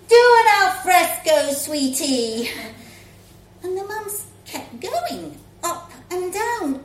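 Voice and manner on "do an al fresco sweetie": loud and high on the first words, dropping on sweetie; breezy shouted instruction; cheerful shout. Acted. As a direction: call it across the playground. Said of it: "her mum just shouted".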